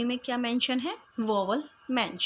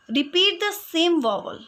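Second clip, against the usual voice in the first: slow dictation; 4 semitones higher, 4 dB louder, much slower than usual. Shown as a demonstration. Teacher reads definition slowly.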